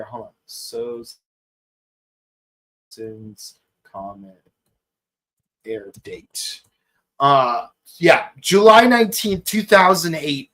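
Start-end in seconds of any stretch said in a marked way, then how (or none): 0.0-6.6 much softer, low aside while checking; muttering to himself. Real, not acted. Host checking the air date, muttered under his breath. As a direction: drop your voice and mutter it to yourself.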